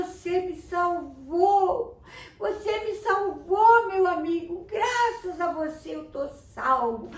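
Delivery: high, loud, slow overjoyed shout with laughter; rescued boy thanking his smelly friend, overjoyed; excited thanks. Acted. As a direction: gush with relief and glee. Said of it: "olhou pra ele e falou".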